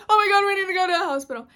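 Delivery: fast, high, loud panic; panicking. Retold or imitated. Mom sees the injury, panicked fast yelling. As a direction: imitate a mother yelling in a rush.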